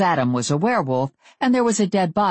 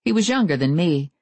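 ordinary delivery

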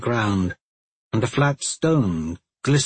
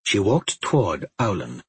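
usual speaking voice

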